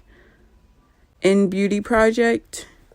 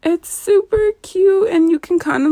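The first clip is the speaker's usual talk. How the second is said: excited; 10 semitones higher than usual. Real, not acted. Excited about a cute product.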